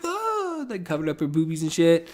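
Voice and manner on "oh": mock gasp. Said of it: "she's like".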